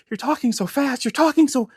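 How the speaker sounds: high-pitched girlfriend imitation; imitated girlfriend. Girlfriend's complaint acted out in retelling.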